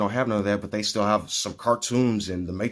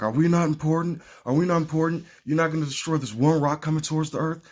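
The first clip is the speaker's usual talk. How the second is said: indignant crowd; 9 semitones higher, 3 dB louder, faster than usual. Retold or imitated. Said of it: "all the people on Earth are like".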